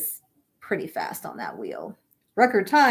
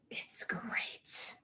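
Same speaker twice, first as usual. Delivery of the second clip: whispered aside; 14 dB quieter than usual. A narrator's reading. Sarcastic aside.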